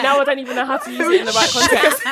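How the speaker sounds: loud, laughing through the words. Real, not acted. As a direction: burst out laughing mid-sentence. Laughing at her own slang.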